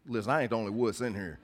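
ordinary talk, nothing marked

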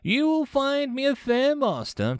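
high, gloating overlord; conquering commander. Acted. Conqueror to surrendered president, imperious.

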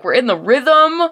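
usual speaking voice

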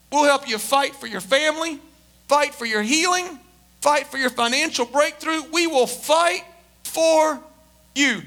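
rally the congregation to fight; building, emphatic pulpit call; impassioned preaching. Real, not acted. Preaches with force.

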